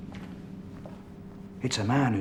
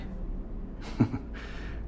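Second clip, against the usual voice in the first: scornful laugh; 7 dB quieter than usual. Acted. Suspect laughs mockingly.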